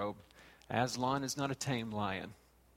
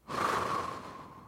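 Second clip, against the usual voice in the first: long breathy sigh; weary sigh. Retold or imitated. Jesus sighing before asking how long, a weary sigh. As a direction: let out a tired sigh. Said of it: "Jesus sort of sighs and says".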